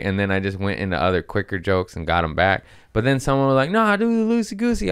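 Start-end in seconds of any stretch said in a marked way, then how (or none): 3.7-4.9 very high, quick caller's voice; imitated audience member. Audience member requesting a story, high mocking imitation. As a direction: pipe up high and fast, mocking.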